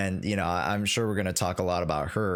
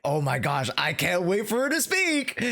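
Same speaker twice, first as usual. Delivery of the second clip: retold squeal of eager anticipation, pitch climbing high; excited anticipation; 9 semitones higher than usual. Fan recalling waiting to hear a character speak, excited. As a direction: gush it high, reliving the excitement.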